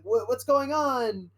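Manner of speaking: high, slow alarmed droid voice; confused droid. Retold or imitated. Droid when the ship gets lost, high and slow. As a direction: panicky robot asking what's happening. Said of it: "S M thirty-three is like".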